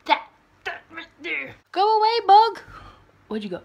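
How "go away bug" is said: high, loud yelp at a bug; startled shout. Real, not acted. Startled by a bug in her room, a loud high yelp. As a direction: shoo the bug away with a sudden shriek.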